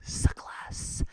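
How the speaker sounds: unvoiced breathy whisper; whispered taunt. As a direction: whisper it as a sly jab.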